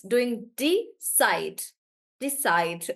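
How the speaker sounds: usual speaking voice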